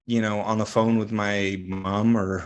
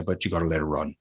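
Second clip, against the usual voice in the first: lower, softer Newfoundland lilt; Newfoundland accent; 4 semitones lower, 5 dB quieter, faster than usual. Retold or imitated. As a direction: let it drop into an imitated Newfoundland accent. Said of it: "I'll butcher it".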